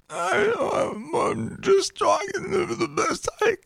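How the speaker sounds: tight, very high, strained croak; strangled voice. Retold or imitated. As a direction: squeeze it out like being choked. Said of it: "Sounds like he's being strangled".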